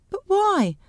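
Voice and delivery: high-pitched, puzzled question; baffled protest. Acted. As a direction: question it in wounded bewilderment. Husband protests the order.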